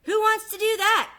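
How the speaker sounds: high, loud squawk of protest; first duckling crying out in protest; duckling cries. Acted. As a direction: cry it out indignantly. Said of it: "cries duckling number one".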